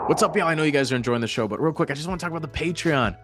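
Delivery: bright, quick sponsor pitch over music; upbeat ad read. Acted. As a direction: sell the Patreon like a radio host. Host reads Patreon ad.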